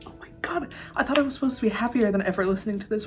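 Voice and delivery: breathless, stunned whisper; awed whisper. Real, not acted. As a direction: whisper it in disbelief. Awed whisper after song.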